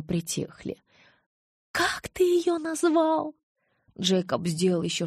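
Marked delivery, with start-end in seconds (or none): 1.8-3.3 slow, very high, incredulous demand; outraged high-pitched question; appalled. A narrator's reading. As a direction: stretch it out in rising fury. Appalled mother hears the nickname.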